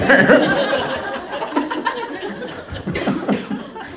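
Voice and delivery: silent, wheezing high laugh; helpless laughter at his own story; breathy high laugh. Real, not acted. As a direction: laugh almost without voice.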